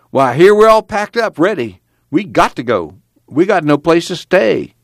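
high, mildly exasperated arguing; urgent protest. A narrator's reading. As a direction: argue that there is no choice. Pa argues with stubborn Grandpa.

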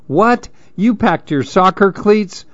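high-pitched, shocked questioning; shocked mother. A narrator's reading. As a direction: react in disbelief, voice jumping up. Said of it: "Mammy was shocked".

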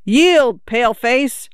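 put-on girl challenging her father in a make-believe battle; shouted playful command. A narrator's reading. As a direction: yell it like a war cry in the game. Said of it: "shouted at the top of her voice".

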